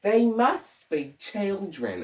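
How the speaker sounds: high, slow mock Mid-Atlantic diction; amateur old-film Mid-Atlantic, pitched high; attempted Mid-Atlantic accent. Shown as a demonstration. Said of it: "do a Mid-Atlantic accent".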